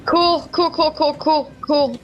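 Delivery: high, anxious repeated cool; nervous high cool. Real, not acted. Nervous reaction to danger.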